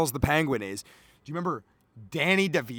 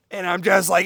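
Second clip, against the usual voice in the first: normal talking voice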